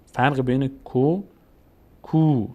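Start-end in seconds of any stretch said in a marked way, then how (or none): 0.8-1.2 کو as short "ko"; short vowel. Shown as a demonstration. Teacher contrasts the two vowels, short "ko". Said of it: "فرق بین ضمه و صدای کشیده". 2.0-2.5 کو as long "kuu"; long vowel. Shown as a demonstration. Teacher contrasts the two vowels, long "kuu". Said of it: "صدای کشیدهٔ او".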